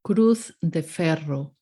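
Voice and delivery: Cruz de Ferro with a trilled rr in Ferro; rolled r. Shown as a demonstration. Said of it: "master level of rolling the R's".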